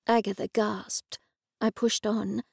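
ordinary delivery

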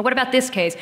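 usual speaking voice

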